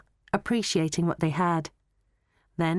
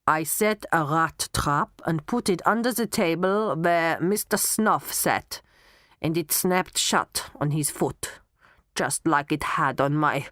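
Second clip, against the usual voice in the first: put-on German accent. Knockman confessing about the rat trap, German accent. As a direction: confess in a German accent, stumbling on the last word. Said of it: "you will talk in a German accent".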